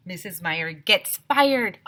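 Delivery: slow, loud announcing of the title; dramatic title read; chapter title. Shown as a demonstration. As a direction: announce it like a headline.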